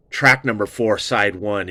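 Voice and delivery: ordinary delivery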